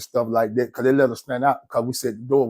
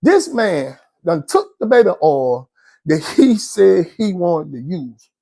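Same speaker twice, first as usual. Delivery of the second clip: ordinary talk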